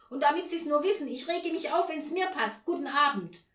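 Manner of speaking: loud, high, defiant parting shot; irate outcry. A narrator's reading. Neighbour ends the quarrel at the door, irate outcry. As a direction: storm off with a furious last word. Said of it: "rief Frau Janka erbost".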